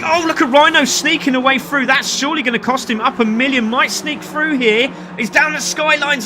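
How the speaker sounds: high, animated play-by-play call; excited race commentary. Real, not acted. Commentator calling Rhino sneaking away through, excited. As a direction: raise the pitch as the move unfolds.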